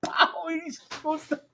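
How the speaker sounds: high, slow words through laughter; can barely get it out for laughing. Real, not acted. Telling friends about cracking Rainville, slowed by high laughter. Laughing at his own story.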